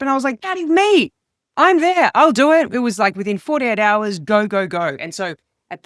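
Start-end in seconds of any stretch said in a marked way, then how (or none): 0.4-2.7 much higher and louder, bursting with eagerness; eager yes. Retold or imitated. Comedian leaping at a cruise ship booking, eager and high. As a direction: jump at the offer like it's a dream.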